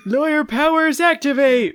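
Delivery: high, loud superhero call; hammed-up heroic yell; mock battle cry. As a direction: shout it like a cartoon transformation.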